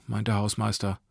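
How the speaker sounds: ordinary delivery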